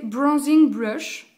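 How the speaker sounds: slow, self-mocking English accent on the brand name. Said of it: "J'ai un accent, euh, anglais super magnifique".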